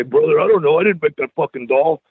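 a light rasp of a wrestler's voice; Jake the Snake impression. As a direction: lightly play the wrestler doll shrugging it off. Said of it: "I always used to tell Jake".

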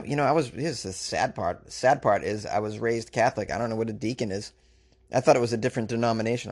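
ordinary talk, nothing marked

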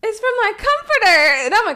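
fretting over the dog's safety; anxious rising voice; worried cry. Real, not acted. Worried dog ate foam.

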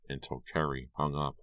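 ordinary delivery

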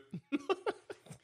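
high, soft giggle; mock girly giggle. Girlfriend giggling at the wife, high and soft.

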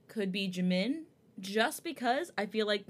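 normal talking voice

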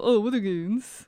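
drawn-out imitation British "Oh, Withergoons"; mock British accent. Co-host mimicking British people naming the pub, mock British accent. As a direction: put on a British accent, stretching it slowly. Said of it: "British people call it?".

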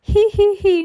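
very high, loud tee-hee; giggling. A narrator's reading. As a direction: let out a squeaky put-on giggle. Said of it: "Nero stopped giggling".

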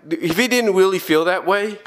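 usual speaking voice

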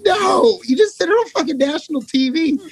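high, aghast cry of disbelief; horrified disbelief. Retold or imitated. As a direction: react as if your secret just went public. Horrified that it aired on TV.

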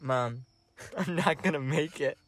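soft, low mock-dying voice; hammed-up feeble resignation; feeble plea. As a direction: play weakly giving up, quiet and low. Mock-weak plea to mom in bed.

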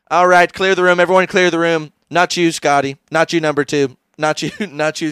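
loud mock Doctor Evil orders; Doctor Evil command. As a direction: order the room cleared. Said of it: "Goldmember is like".

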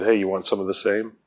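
low, quiet menacing threat; threatening. Retold or imitated. Guards threatening the graffiti witness, low quiet menace. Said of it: "They threatened the graffiti artist".

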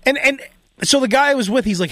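normal talking voice

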